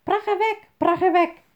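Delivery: high quacking cry; waddling duck calling out; duck's call. A narrator's reading. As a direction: squawk it like a limping duck. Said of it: "mit dem Aufruf".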